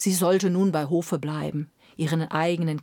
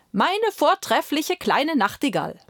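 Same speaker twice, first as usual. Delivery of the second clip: lightly voiced flattering steward; 7 semitones higher than usual. Steward addresses nightingale.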